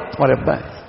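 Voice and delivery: soft, low passing statement of fact; informing. Shown as a demonstration. Preacher giving news about the child's father, soft informing tone. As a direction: state it quietly, letting it drop. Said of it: "خبر بھی دے رہا ہوں".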